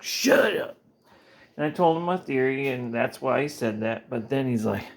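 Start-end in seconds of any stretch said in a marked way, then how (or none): 0.0-0.8 high snap. Retold or imitated.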